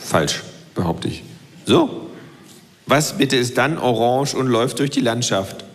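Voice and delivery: dry low self-voice. Acted.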